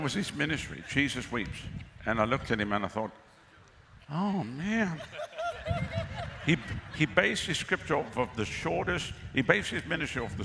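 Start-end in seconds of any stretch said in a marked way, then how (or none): 4.1-6.4 high squealing laugh breaking out; burst of laughter. Real, not acted. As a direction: laugh it off, can't hold it in. Laughs at the ministry name.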